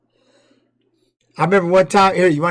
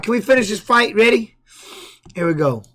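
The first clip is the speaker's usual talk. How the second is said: ordinary talk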